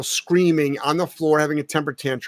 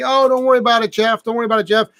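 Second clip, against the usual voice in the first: mock reassurance; 6 semitones higher, faster than usual. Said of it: "people can say".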